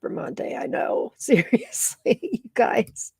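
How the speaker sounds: whispered, choked with tears; tearful whisper. Real, not acted. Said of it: "You've got me tearing up".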